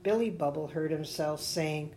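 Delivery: ordinary delivery, nothing marked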